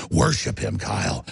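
ordinary talk, nothing marked

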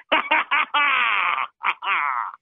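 long, hammed-up cackle; evil villain laugh. Caller posing as Gorka after his own boast, villain cackle.

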